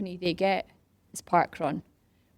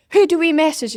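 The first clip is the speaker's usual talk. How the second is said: loud, high call; roused crowd's demand; 9 semitones higher, 11 dB louder than usual. Retold or imitated. Crowd wanting to message councillors, loud urgent demand.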